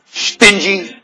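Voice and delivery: high, loud, slow preacher's hammering questions; fiery preaching. Real, not acted. Preacher demanding answers from congregation, fiery preaching. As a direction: drive each question home to the crowd.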